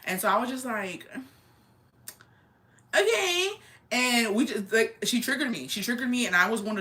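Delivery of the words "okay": high, louder acted squeak; taken aback. Daughter answering her mom's insults, taken aback. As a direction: pitch it way up in mock disbelief.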